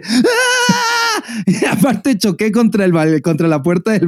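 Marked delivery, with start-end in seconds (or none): 0.0-1.4 high shrill-free startled yelp, pitched way up; retold scream. Scared of the bee flying at his face, a high scream. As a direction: let out a sudden high scream of fright. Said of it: "pero neta grité así de".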